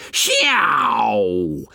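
long swooping imitation plane noise, falling in pitch; boy's playful dive-bomber screech, sliding downwards; fighter plane noise. A narrator's reading. Ralph plays fighter plane attacking Piggy, swooping engine noise. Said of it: "machine-gunned Piggy".

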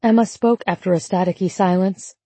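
ordinary delivery, nothing marked

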